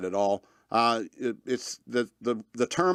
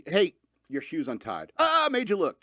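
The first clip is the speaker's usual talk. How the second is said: mock gotcha, jumping higher on "made you look"; playful prank; 7 semitones higher than usual. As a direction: tease like a kid on the playground. Playground prank line.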